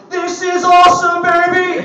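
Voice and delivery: hammed-up Dick Vitale catchphrase. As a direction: hype it like a college hoops broadcaster. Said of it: "Dickie V, all, you know, Dick Vitale".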